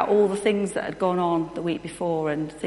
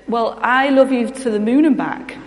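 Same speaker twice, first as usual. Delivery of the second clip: louder and higher, proudly topping her mother; daughter's loud boast; 4 semitones higher, 11 dB louder than usual. Retold or imitated. Little daughter outdoing her mum, loud proud boast. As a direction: shout it like a kid who must win. Daughter voiced, escalating love contest.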